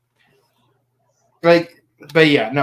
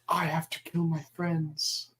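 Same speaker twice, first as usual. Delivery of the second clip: unvoiced dramatic whisper; 13 dB quieter than usual. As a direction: breathe it out like a dark realization. Character's grim realization.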